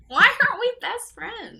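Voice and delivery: high, loud, playful question; playful gush. Acted. Asking her coworker, playful and loud. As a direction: gush it, half laughing.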